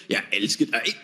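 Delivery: comic demo of Danish "I love you", dropped low; Danish phrase. Shown as a demonstration. Danish, deep and low. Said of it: "in Danish".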